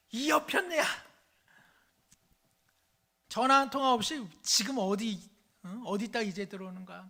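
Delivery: 아내에게 버럭 소리치는 남편, 높고 크게; 이 여편네야, 하고 크게 호통쳐; 화난 남편의 고함. Acted. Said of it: "남편이 또 한 소리 하죠". (늦게 온 아내에게 호통치는 남편, 높고 큰 고함)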